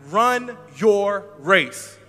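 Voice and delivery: loud drawn-out chant; congregation call-out. Shown as a demonstration. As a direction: call it out slowly. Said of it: "Can we repeat that?".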